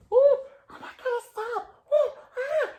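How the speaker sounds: high, loud mock flustered cries; muffled shouts. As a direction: voice Papa and the Cubs panicking in the kitchen. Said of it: "some muffled shouts".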